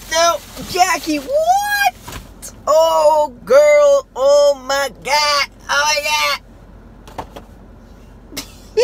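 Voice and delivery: high, loud gasps of joy; excited squeals. Real, not acted. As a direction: squeal it out, overwhelmed with delight. Reacting to fan mail gift.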